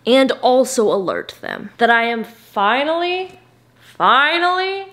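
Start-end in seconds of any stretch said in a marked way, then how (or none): 2.2-4.9 rising much higher on finally; excited. Real, not acted. Vlogger telling viewers she finally enjoys a book, excited higher. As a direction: squeal out your relief, higher each time. Joyful building exclamation.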